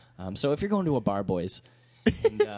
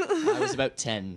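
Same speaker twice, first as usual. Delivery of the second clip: laughing; slower than usual. Real, not acted.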